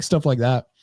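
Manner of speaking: ordinary talk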